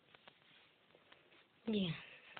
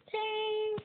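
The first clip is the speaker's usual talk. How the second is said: high ding sound; 13 semitones higher, 4 dB louder than usual. Real, not acted. Imitates a game sound.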